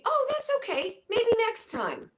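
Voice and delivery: high breezy let-off; cheery easygoing reply. Retold or imitated. Friend replying to a refusal, high breezy cheer. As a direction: chirp it like someone happily letting you off. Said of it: "the response be".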